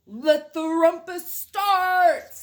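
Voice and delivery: loud, slow royal shout; cried out. A narrator's reading. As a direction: bellow it like a wild little king. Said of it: "cried Max".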